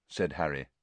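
plain reading voice

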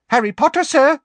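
very high, loud squeaky elf cry; high excited elf voice; squeaky house elf. Acted. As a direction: squeak it out like an eager little elf. Dobby wakes Harry.

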